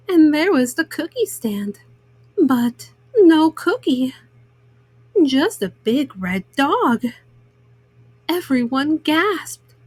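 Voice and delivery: plain reading voice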